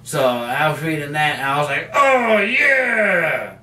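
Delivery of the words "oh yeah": high, drawn-out cheer falling away; re-enacted thrill. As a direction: relive a fan's whoop of delight. Reliving own thrilled reaction while reading.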